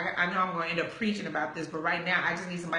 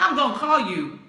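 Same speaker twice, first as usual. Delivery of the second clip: higher, louder "I'm gonna call you"; firm promise; 6 semitones higher, 8 dB louder than usual. Retold or imitated. What she wanted the boyfriend to say, emphatic. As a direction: punch it as the promise he never made.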